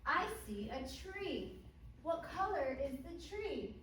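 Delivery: over-enunciate for beginner English learners; kids show host. Shown as a demonstration. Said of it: "But I want to do one with you".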